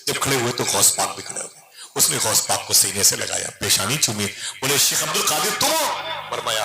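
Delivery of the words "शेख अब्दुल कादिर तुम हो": high, eager question to a long-lost relative; amazed question. Acted. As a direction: ask as if you can't believe it. Traveller asks in amazement. (हैरानी भरा ऊँचा सवाल)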